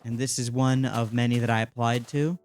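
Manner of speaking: usual speaking voice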